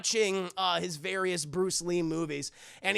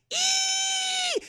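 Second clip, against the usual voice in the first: shrill mock shriek, much higher; high-pitched scream; 4 dB louder than usual. [name] scares everyone when the light breaks, high-pitched scream. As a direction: squeal it out short and sharp. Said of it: "this high-pitched scream".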